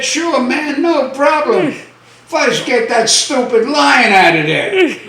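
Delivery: put-on high-pitched, cocky old guy's reply; eager punchline. Old guy answers the lion owner's dare, eager. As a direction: pitch it up, acting eager and unfazed.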